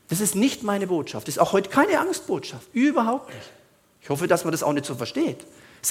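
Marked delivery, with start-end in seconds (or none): none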